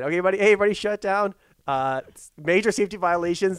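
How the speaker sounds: loud retold call to shut the site; hammed-up site shutdown shout; mock shutdown call. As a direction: bark the order like the safety boss. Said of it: "I said".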